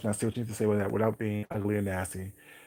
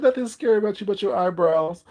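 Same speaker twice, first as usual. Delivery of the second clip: laughing retelling; 8 semitones higher, 6 dB louder than usual. Retold or imitated. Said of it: "He said".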